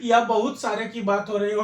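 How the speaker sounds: ordinary talk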